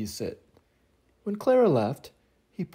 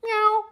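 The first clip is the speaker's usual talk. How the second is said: a small, high cat meow; high kitten meow. Acted. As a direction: mew like a little kitten. Kitten sound.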